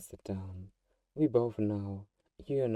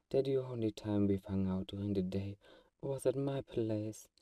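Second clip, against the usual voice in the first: usual speaking voice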